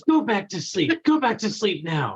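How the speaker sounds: high-pitched mock snapping order; imitated angry order. Mom imitates daughter ordering sister to bed, mock angry. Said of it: "said it without saying it".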